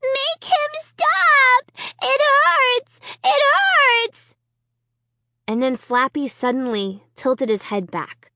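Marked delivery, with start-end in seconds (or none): 0.0-4.3 put-on very high, loud, slow child's scream of pain; a toddler shrieking for help. A narrator's reading. Little Alicia screaming to her mother that it hurts.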